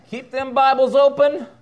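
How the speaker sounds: real loud joking instruction; playful command. Real, not acted. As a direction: call the room back, laughing. Teasing congregants closing Bibles.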